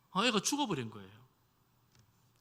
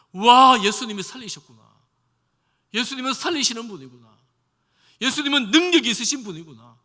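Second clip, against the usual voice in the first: loud, higher, awestruck exclaiming; amazed crowd; 6 semitones higher, 13 dB louder than usual. Retold or imitated. Onlookers amazed the boy rose again, loud and awestruck. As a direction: gasp in wonder at the miracle.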